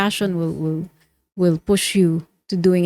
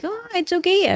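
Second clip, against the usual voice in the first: much higher-pitched mock parent voice; dismissive parent; 12 semitones higher than usual. Host voices parents declining entrepreneurship for their child, dismissive. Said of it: "some parents might think".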